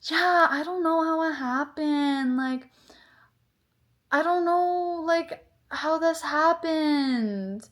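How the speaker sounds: high, slow, drawn-out clueless mimicry; mock the roommate as helpless and unbothered. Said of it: "And she's like".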